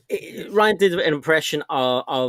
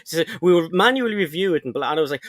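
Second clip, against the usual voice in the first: ordinary talk, nothing marked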